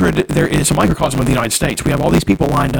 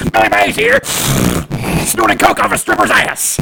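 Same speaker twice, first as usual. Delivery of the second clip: Billy Mays impression; 7 semitones higher than usual. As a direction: punch it like a TV pitchman. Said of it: "Billy Mays without a gruff voice".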